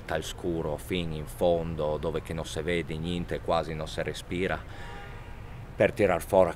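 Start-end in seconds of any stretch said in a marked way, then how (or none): none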